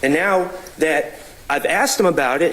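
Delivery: normal talking voice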